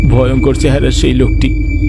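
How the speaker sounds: usual speaking voice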